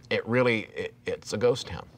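usual speaking voice

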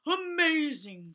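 slow, lightly voiced bear awe. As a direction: draw it out like a bear. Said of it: "said the real bear".

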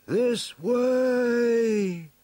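high, loud, long call; high carrying shout of direction. A narrator's reading. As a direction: call down from the air, holding the last word. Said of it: "he swooped low and called".

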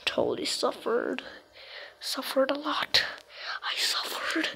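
put-on whispered voice of suffering; silly suffering voice. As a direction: whisper it like a tortured soul. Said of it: "Why am I making voices like that?".

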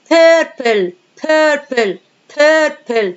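stamp out purple as two syllables; syllable drill. Shown as a demonstration. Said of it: "قسمناها two syllable".

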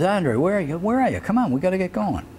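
high, hurried calling out for a late friend; impatient calling. Retold or imitated. Calling a late friend up at the hotel, impatient and high. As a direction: call up the stairs, come on, hurry.

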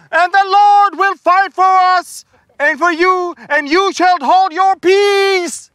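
high, loud scream, last words slow. Acted. Moses screaming at the murmuring people, loud and high. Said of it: "He screamed".

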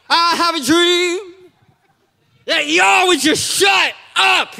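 high, slow mock-oratory building to a shout; parody oratory. Preacher parodies a historic speech to a crowd, mock oratory. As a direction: declaim it like a famous speech, then blurt the punchline.

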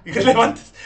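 Irrelevantes said right with a laugh; laughing correction. Real, not acted. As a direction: laugh while fixing it to Irrelevantes. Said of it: "perdón". (corrige riendo: Irrelevantes)

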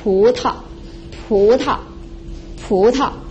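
pu climbs, ta follows short and light; rising plus neutral tone. Shown as a demonstration. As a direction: lift pu, then tuck in a short ta. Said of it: "So we combine these two characters".

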